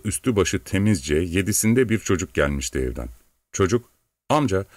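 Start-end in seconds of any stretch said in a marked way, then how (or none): 4.3-4.6 put-on higher-pitched child's call to an uncle. A narrator's reading. Little boy calls the servant from the yard, calling out. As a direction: call for attention, pitch jumping up on each word. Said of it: "diye sesleniyordu".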